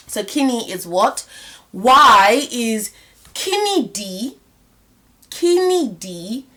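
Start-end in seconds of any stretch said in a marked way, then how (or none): 3.3-6.5 slow demo. Shown as a demonstration. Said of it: "which literally means".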